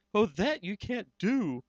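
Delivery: lightly voiced anxious Horton; urgent elephant. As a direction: beg the tiny mayor to act. Horton's voice in the story.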